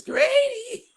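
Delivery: mother calling her son while he is not allowed to talk. Retold or imitated. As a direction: call for Grady like a mother across the house. Mother calling him from home.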